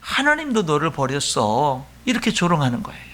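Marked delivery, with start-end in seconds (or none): 0.0-1.8 slow, low, drawn-out jeer; mocking taunt. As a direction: imitate a scornful taunt, lightly. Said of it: "그들이 조롱하면서 하는 말이".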